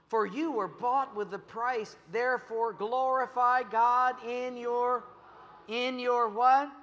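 leading scripture reading. Real, not acted. Preacher leads church in reading Corinthians aloud, slow recitation. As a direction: read it out slowly and loudly for everyone to follow.

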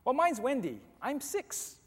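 put-on high, chirpy six-year-old; little girl. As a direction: introduce yourself like an eager small child.

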